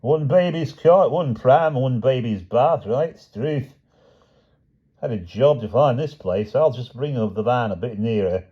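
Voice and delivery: high, chatty Cockney delivery man; cheerful Cockney patter; a Cockney van driver reading his list. A narrator's reading.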